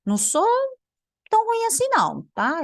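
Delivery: high-pitched "tão ruim assim não" in indignant protest. Real, not acted. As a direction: defend yourself with mock outrage. Defends her own skill. (protesto indignado em tom agudo)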